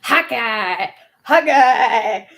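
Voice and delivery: loud, put-on character shout of the name; hot guy impression. Streamer showing off one of few impressions, loud hot guy voice. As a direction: belt out the name in an altered character voice. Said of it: "I can do a hot guy impression".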